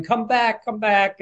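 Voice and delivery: pleading-style yell at normal volume, pitched high; retold shout. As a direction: imitate someone calling a friend back. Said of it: "Lou's shouting".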